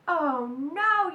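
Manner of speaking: loud cry of dismay; mother shouts in alarm; yelled. Acted. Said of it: "yelled her mother".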